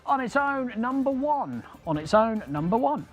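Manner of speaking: bingo caller. Acted. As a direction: call the number like a bingo hall. Calling the ball.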